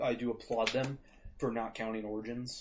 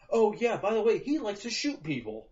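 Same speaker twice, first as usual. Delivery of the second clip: mock casual reveal; 9 semitones higher, 5 dB louder than usual. Imagined crew casually revealing a secret.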